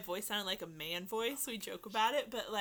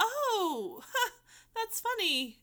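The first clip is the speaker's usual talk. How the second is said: high, slow nervous laugh; awkward laugh; 10 semitones higher, 4 dB louder, much slower than usual. Retold or imitated. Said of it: "I was like".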